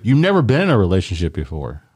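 ordinary talk, nothing marked

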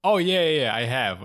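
ordinary talk, nothing marked

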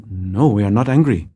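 quiet denial. Acted. Imitating Germans denying anger, quietly restrained. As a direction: insist with tightly contained feeling. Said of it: "it's like a quiet sort of".

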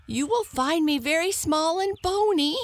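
high, fast squeaky hare voice; squeak. A narrator's reading. Hare pleads with lion, high fast squeak. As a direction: squeak it quickly. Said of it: "squeaked the hare".

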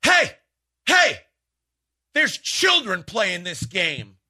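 prim, high, slow scolding; imitating an offended mom in the stands; a mom in the stands scolding the coach, prim and high; mock upset mom. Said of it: "One of the moms from the other team".

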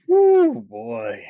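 high whoop then drop; a loud surprised whoop. Real, not acted. Reacting to the bad movie.

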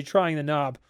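plain reading voice

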